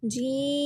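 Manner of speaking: जीम stretched five alifs; drawn-out jeem; long jeem. Shown as a demonstration. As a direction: hold जीम long for the madd. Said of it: "जीम को मैंने लंबा क्यों किया".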